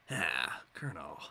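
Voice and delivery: low gravelly whisper to the Colonel; Snake voice. Pretend. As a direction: growl it like a weary soldier on comms. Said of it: "I have to do the Snake voice".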